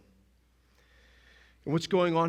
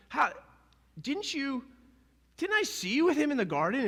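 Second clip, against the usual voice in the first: nosy servant; 10 semitones higher than usual. Retold or imitated. Servant accusing Peter.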